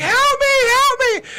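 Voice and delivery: high-pitched mock cries for help; imitated terrified scream. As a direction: shriek it like a scared roommate. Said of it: "she screams, and she's like".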